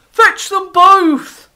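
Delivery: slow, booming wolf shout. Acted. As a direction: bellow it like a greedy wolf. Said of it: "shouted the wolf".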